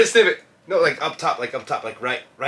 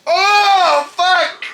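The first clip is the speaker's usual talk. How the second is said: stunned outburst; 12 semitones higher, 4 dB louder than usual. Real, not acted. Stung by smelling salts.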